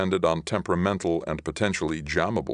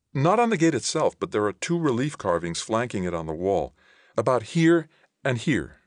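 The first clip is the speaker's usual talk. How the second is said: higher-pitched voice for the woman character; Ilona explaining; 6 semitones higher than usual. Acted. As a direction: lift the pitch to answer as her.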